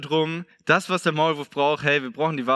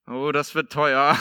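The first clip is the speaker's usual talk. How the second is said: slow, ominous mechanic delivering bad news; lightly voiced mechanic, drawn out; grim mechanic; 3 semitones lower, slower than usual. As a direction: mechanic under the car breaking it to the owner, slow and grave. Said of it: "Und dann sagt er schon so".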